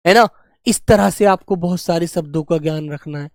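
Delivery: normal talking voice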